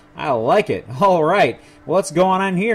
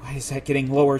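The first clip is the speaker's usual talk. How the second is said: ordinary delivery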